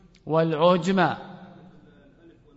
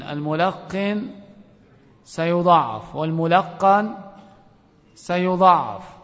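slow, emphatic warning; slower than usual. Real, not acted. Teacher warns against prompting.

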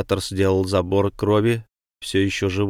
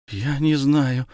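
put-on lost, higher-pitched girl's reply; confused, raised voice; bewildered; 6 semitones higher, slower than usual. A narrator's reading. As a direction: answer as if at a loss. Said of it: "растерянно ответила девушка".

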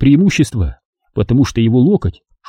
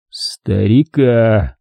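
ordinary talk

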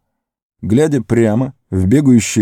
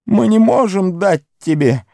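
defiant Gollum; 12 semitones higher than usual. A narrator's reading. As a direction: defy it with a cry. Gollum defying the east.

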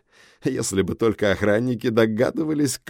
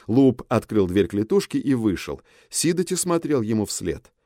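ordinary delivery, nothing marked

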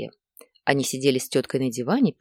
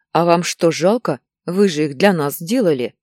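louder, wounded protest; offended; 3 semitones higher, 7 dB louder than usual. A narrator's reading. Nika protests to Natalya Ivanovna, offended. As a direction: sound hurt and put out. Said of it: "обиделась Ника".

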